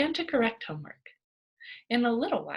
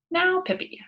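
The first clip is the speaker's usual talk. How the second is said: brisk teacher; 10 semitones higher, 5 dB louder than usual. A narrator's reading. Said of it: "she said briskly".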